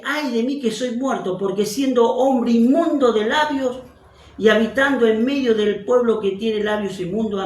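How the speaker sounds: dramatic prophet's confession; anguished confession. Acted. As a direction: pour it out as a guilty man before the King. Rereading Isaiah's confession dramatically.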